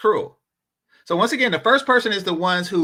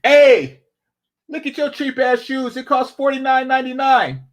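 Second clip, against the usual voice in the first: mock taunting call; 6 semitones higher than usual. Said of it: "point out and say".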